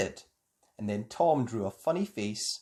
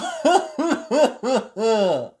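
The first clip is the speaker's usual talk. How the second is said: loud high laugh; gleeful laugh; 8 semitones higher, 8 dB louder than usual. A narrator's reading. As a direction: laugh out in delight. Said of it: "he laughed".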